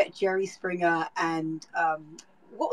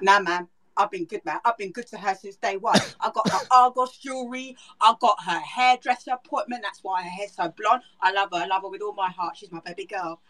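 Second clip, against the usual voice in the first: put-on British boyfriend pleading his case; British trash-TV guest accent. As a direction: play a British talk-show boyfriend protesting his devotion. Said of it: "more of those accents of those people".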